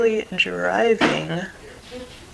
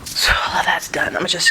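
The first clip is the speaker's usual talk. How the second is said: whispering; 3 dB louder than usual. Real, not acted. Quiet aside while fixing hair.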